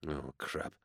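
very soft, low curse; muttered dismay. A narrator's reading. Inquisitor spotting his unwelcome admirer, muttered dismay. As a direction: curse under your breath.

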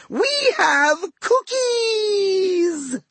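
very high, drawn-out singsong holler; senile gleeful yell. A narrator's reading. Old god Ra calls across the water, high slow yell. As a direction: stretch every word in a high, daft call. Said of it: "he yelled across the water".

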